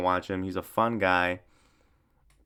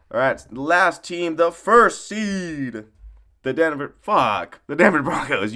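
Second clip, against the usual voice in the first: drawn-out, louder announcement like a host; mock hype introduction; slow announcer voice; 4 semitones higher, 4 dB louder, slower than usual. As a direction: call out the last team slowly. Announcing the last team.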